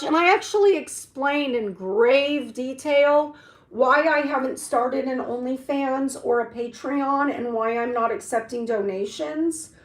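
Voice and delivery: usual speaking voice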